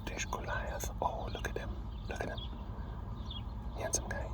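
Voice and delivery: very soft, unvoiced whisper; hushed aside while watching an animal. Real, not acted. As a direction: whisper it as if he might overhear. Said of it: "Why am I whispering?".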